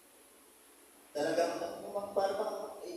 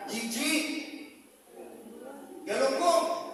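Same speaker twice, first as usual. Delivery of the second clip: shocked cry; 6 semitones higher, slower than usual. Acted. Worker opens lunchbox, sees fish.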